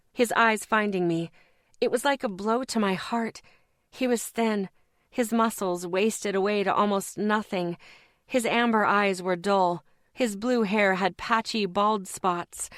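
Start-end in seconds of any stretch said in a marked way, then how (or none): none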